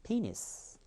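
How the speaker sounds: "penis" in one go, high PEE falling to soft -nis; front stress, joined up. Shown as a demonstration. Teacher gives the finished word to students, front-stressed "penis". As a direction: say "penis" quickly joined, high then dropping. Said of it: "다시 한번 해볼까요?".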